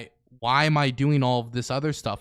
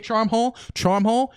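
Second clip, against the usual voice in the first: teasing call-out; 6 semitones higher than usual. Real, not acted.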